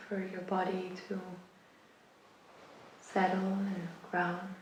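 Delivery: unhurried meditation guidance, long pauses between phrases; guided meditation. Acted. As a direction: guide them gradually into rest, phrase by phrase. Guides listeners into rest.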